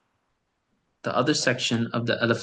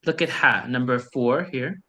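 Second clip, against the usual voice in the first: ordinary talk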